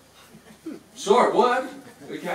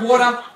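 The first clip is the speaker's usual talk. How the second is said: "water" said louder, landing on "wa"; stressed key word; 5 semitones higher, 8 dB louder than usual. Shown as a demonstration. Snake handler teaching the name, punching "water" loud. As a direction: hit "water" firmly as the word to remember. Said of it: "First word".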